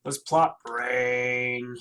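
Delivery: low slow zombie moan. Pretend. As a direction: drag it out like a zombie. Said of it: "I'm gonna sound like a zombie".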